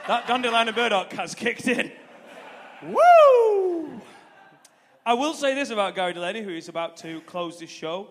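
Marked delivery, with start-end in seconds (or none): 2.8-4.0 loud, high whoop. Real, not acted. Comic carried away on stage, loud high whoop. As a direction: let out a big whoop. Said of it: "I got carried away there".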